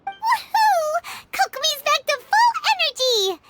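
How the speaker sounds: excited cheer. Acted. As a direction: cheer with delight. Paimon cheering her recovery.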